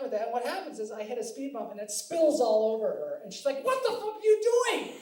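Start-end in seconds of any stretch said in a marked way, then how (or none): 3.6-4.9 high, loud outraged yell, imitating wife; mock-furious scolding, stretching the last words; angry wife. Acts out wife scolding his driving.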